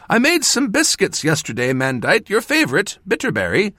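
high, doting mother voice; fond mother. A narrator's reading. Mother calling to her son across the room, doting and high. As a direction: call out sweetly like a proud mother.

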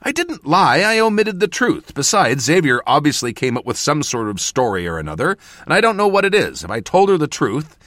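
high, loud, insistent justification; heated self-defence. A narrator's reading. Mentor defending omission to apprentice, heated. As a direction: argue back, agitated.

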